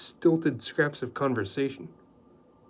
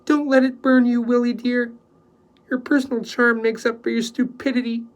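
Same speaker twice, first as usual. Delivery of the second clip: loud, much higher teasing; bantering; 11 semitones higher, 7 dB louder than usual. A narrator's reading. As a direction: tease him cheerfully. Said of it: "in a bantering tone".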